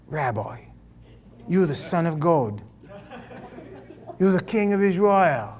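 put-on comic thick Brooklyn accent; softly hammed-up New York accent. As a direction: play it as a Brooklyn local. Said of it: "thick Brooklyn accent here".

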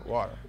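"Water" said low as a plain statement, rounded "waw" vowel; his own WATER. Retold or imitated. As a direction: repeat "Water" low and even, as your order. Repeats the order again.

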